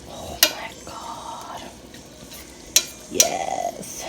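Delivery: very slow, breath-only awe; savouring whisper. Real, not acted. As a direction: whisper it, stretching out every word. Savouring the food.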